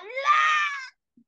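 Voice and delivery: high, drawn-out shriek imitating an audience member; mock squeal, loud and long; mimicked outburst. Comedian imitating an audience member's instant reaction, high mock shriek.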